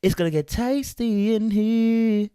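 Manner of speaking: high, loud, drawn-out gleeful exclaim; excited reaction. Retold or imitated. Reacting to the comment's opener, high and gleeful. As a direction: relish it, voice up high and slow. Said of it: "I said".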